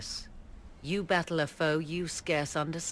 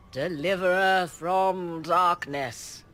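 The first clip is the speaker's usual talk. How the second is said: slow, louder mock drunken toast; 3 semitones higher, 6 dB louder, slower than usual. Mocking the emperor's drunken toast to the hero, slow and loud. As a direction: ham up a tipsy emperor raising his glass. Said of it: "Gaspard drunkenly toasts in your honor".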